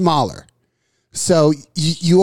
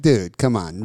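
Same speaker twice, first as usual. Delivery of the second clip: normal talking voice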